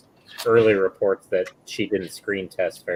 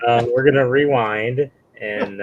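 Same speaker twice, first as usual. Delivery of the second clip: ordinary talk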